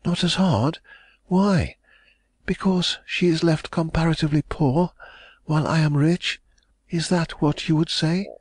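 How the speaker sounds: hopeful heiress pressing for news; young lady, anxious. A narrator's reading.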